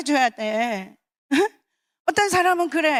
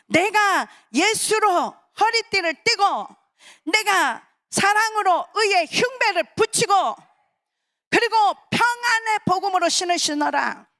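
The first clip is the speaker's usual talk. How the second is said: raised, impassioned sermon voice; preaching; 6 semitones higher than usual. Acted. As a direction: preach it high and fervent. Preaching at full force.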